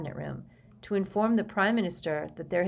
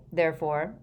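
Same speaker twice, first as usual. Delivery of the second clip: ordinary delivery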